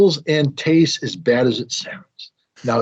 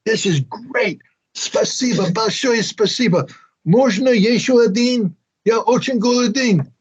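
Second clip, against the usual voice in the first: high, eager, overdone thanks; mock enthusiasm; 11 semitones higher, slower than usual. Guest asking the cooks for another omelet, hammed-up enthusiasm. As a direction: ham up loving it. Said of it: "I'm like".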